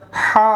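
হা from the middle of the throat; throat sound. Shown as a demonstration. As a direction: push the h of হা out from mid-throat. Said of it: "এটা আমরা হলকের মাঝখান থেকে উচ্চারণ করব". (গলার মাঝখান থেকে হা)

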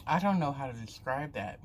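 chewing between words; talking while chewing. Real, not acted. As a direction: mouth partly full of candy. Seen 3: Food put in mouth at -12; cheeks bulge, chewing until ~1.9.

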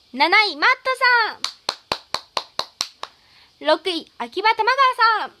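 loud, high announcer call of each rank; ranking announcement. Acted. Streamer to viewers, announcing the gift ranking loudly. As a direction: call out the ranks like a show host.

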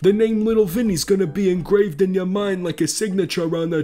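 a hint of a menacing Italian mobster; Italian mob accent. Vinny threatens Thomas over his turf in a light mob accent. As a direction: threaten him like a mafia boss, lightly voiced. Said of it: "speak in this accent because I'm Vinny".

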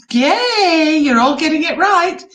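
high, loud delighted praise; cheering. Real, not acted. Teacher praising the group's right answers, cheering.

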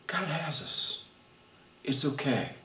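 soft, quiet reassurance; calm them without pointing fingers; reassuring voice. Pretend. Preacher soothing overwhelmed listeners, soft reassuring. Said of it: "that reassuring voice".

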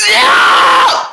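high hammed-up yell; sudden squawking scream; mock pained scream. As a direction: yelp as if just slapped. Slapped by the gator.

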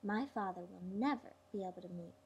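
breathy whispered aside; hushed secret thought. A narrator's reading. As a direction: whisper it to herself, relieved. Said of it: "she thought".

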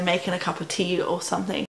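ordinary talk

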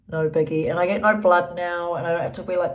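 ordinary talk, nothing marked

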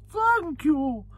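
mate's very high mock-royal catchphrase; Queen Mother. Mate mimicking the royal whenever Cheltenham comes up, very high. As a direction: put on a high-pitched royal impression. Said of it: "he always goes".

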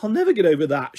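ordinary delivery

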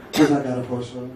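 low-pitched, weighty macho question; heavy tough guy. Shown as a demonstration. Coach modelling the basketball player's line, low and heavy. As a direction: drop it right down, heavy and cocky. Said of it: "σε θέλω βαρύ και ασήκωτο".

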